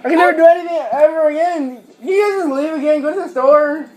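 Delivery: loud, high, exasperated scolding; angry yelling. Real, not acted. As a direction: yell at them for ruining it. Exasperated rant at family.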